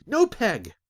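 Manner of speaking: high, loud anguished wail. Acted. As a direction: cry out in despair. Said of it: "Azric wailed".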